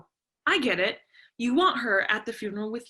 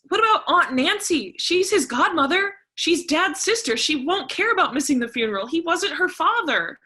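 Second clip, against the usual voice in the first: higher, sharp rapid objections; heated argument; 6 semitones higher, 3 dB louder than usual. Acted. Daughter arguing with mother over the funeral, heated and higher. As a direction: fire back every reason.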